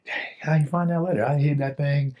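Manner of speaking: astonished. Retold or imitated. Son to dad who found the hidden letter, astonished. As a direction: caught out, incredulous retelling of surprise. Retells surprise at dad's discovery.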